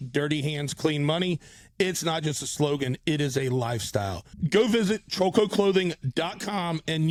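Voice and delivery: punchy, emphatic promo delivery; loud, deliberate podcast sponsor spot; sponsor ad read. Acted. Recorded-style sponsor ad read by host.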